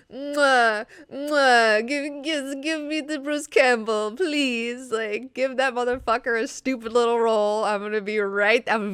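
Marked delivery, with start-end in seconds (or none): 0.1-1.9 loud, high smacking kisses; smacking kiss sounds. Real, not acted. Vlogger kissing at the thought of Bruce Campbell, loud smacks. 1.9-7.7 high, eager pleading; excited begging. Real, not acted. Vlogger begging the film for Bruce Campbell, high eager plea.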